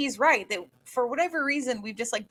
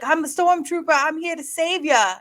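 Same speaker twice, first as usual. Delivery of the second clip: loud, higher-pitched London stormtrooper; South London accent; 6 semitones higher, 5 dB louder than usual. Retold or imitated. Actor auditioning as a stormtrooper, imitated South London accent. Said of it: "do it in his normal accent".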